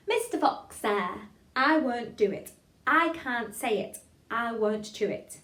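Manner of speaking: slow, loud, every word articulated; tongue-twister recitation. Shown as a demonstration. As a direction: chant it deliberately for a class to follow. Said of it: "say it a couple more times together".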